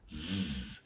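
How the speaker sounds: a held, buzzing Z sound; voiced Z. Shown as a demonstration. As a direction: buzz a long "zzz". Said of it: "vocal cords are vibrating".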